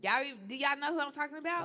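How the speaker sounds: loud, fast tipsy question. Real, not acted. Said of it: "I'm a little tipsy right now".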